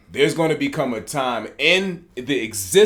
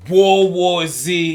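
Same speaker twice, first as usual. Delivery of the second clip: slow, louder name reveal; slow announced name; 6 semitones higher, 8 dB louder, much slower than usual. Shown as a demonstration. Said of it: "Fresh off of real life".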